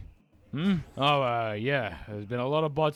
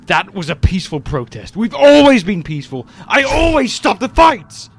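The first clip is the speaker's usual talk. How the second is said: building to a furious shout, much higher and louder; anger boils over into a yell; indignant cry that breaks into a roar; rising anger; 8 semitones higher, 17 dB louder than usual. Acted. Megatron's angry outburst over arrests.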